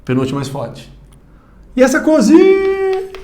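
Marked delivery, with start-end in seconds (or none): 1.8-3.1 very high call, long held "zi" in "cozinha"; demonstrated shout. Shown as a demonstration. Teacher demonstrates the tonic syllable, high drawn-out call on "cozinha". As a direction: holler it, stretching the stressed i of "cozinha". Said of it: "Onde o seu grito mais estender".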